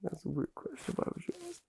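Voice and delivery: small, high, weepy voice; soft squeak through the tears, thrown by the question; quietly whimpered protest; tearful bewilderment. Acted. Still tearful, thrown by odd question.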